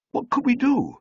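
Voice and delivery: put-on helpless young man. A narrator's reading. Young man's helpless question.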